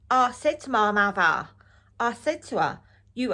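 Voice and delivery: loud hammed-up pantomime Cockney accent; mock the narrator's overdone Cockney, loudly. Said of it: "Real pantomime Cockney".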